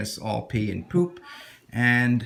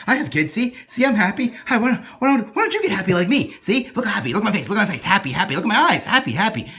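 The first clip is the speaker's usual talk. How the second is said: high, loud, manic insistence on being happy; a strained parent forcing a grin, pushing it too hard; crazy psycho parent; 9 semitones higher, 5 dB louder, faster than usual. Acted. Stressed parent insisting to a childless man they're happy, high and manic. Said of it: "a crazy psycho parent".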